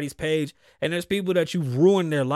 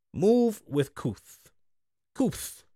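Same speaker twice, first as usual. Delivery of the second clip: slow emphasis; 3 semitones higher, much slower than usual. Real, not acted. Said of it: "slow emphatic phrase".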